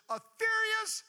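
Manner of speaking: high, loud scream on furious. Acted. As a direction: scream the word to paint the storm. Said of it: "I'm screaming".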